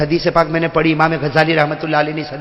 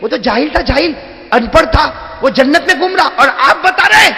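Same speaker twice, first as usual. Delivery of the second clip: astonished; 6 semitones higher than usual. Retold or imitated. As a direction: build from puzzled to amazed. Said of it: "میں حیران ہو گیا". (حیرت سے بلند ہوتی آواز)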